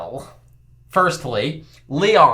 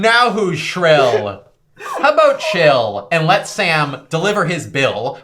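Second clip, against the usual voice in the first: slow mock rebuke; 4 dB louder, slower than usual. Rhyming rebuke.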